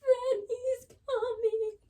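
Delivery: high, slow, tearful wail; sobbing. Retold or imitated. Telling her mom on the bathroom floor, slow high sobbing. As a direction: cry the words out through sobs. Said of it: "sobbing on the bathroom".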